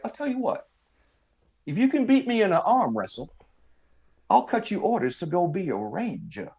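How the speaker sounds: high, lilting Southern drawl caricature. Retold or imitated. Clerk offering orders for an arm-wrestling win, mock Southern drawl. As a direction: make the offer in an exaggerated Southern drawl, pausing between phrases. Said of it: "that Southern drawl accent".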